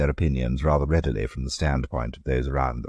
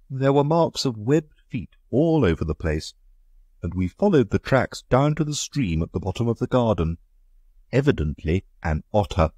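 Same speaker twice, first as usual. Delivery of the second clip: slightly raised, aggrieved country gentleman; Egbert, indignant; 8 semitones higher than usual. Acted. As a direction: complain of the slaughter, put out. Egbert explains.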